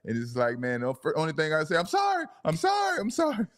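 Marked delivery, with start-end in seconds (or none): 1.8-3.5 high-pitched mock kid apology; imitating a startled child's panicked sorry; caught-off-guard kindergartner. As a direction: squeal it like a little kid caught red-handed. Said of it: "he sound like the kid in".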